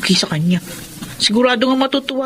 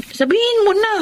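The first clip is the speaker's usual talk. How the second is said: anxious urging; 10 semitones higher than usual. Acted. Sister urges friend to tell.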